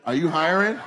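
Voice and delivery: ordinary talk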